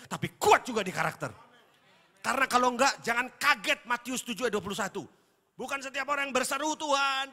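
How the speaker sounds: loud booming sermon voice; preaching at full force. Acted. Preacher booming a warning to the congregation. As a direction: thunder the warning at the church.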